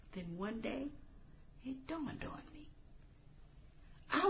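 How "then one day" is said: ordinary delivery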